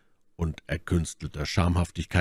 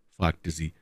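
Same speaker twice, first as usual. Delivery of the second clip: usual speaking voice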